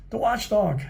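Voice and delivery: high, frightened cry of the name; a startled, scared shout. A narrator's reading. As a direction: shriek it in fright. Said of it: "shouted another, fainting from fright".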